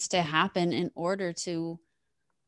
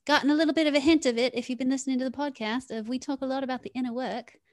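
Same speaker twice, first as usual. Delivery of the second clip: lightly put-on voice, higher and fast; random put-on voice; 6 semitones higher, faster than usual. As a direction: slip into a playful silly voice, higher and quick. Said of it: "I love how we do random voices and accents".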